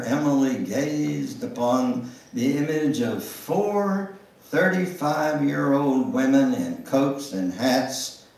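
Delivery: usual speaking voice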